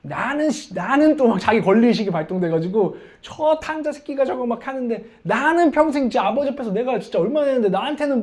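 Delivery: high, aggrieved complaint; angry elder son. Acted. Elder son protesting about his brother, angry. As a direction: act out an indignant son. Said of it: "큰 아들이 화가 났어요".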